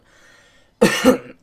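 sharp loud cough into the mic. Real, not acted. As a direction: cough hard, once. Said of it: "I've got a bit of a cough".

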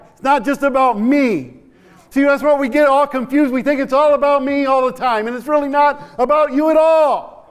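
high, loud fervent preaching; full-force preaching. Acted. Preacher urging the congregation, full force. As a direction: preach it to the back row.

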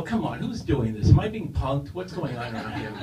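usual speaking voice

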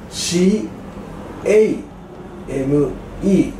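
シーエー エムイー spelled slowly, letter by letter; ゆっくり一文字ずつ. Shown as a demonstration. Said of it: "これcameって発音します".

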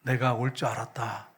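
low, slow, softened divine voice; lightly voiced God, low and unhurried; inner voice of God. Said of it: "음성이 딱 들리는데".

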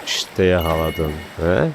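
normal talking voice

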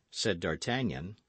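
plain reading voice

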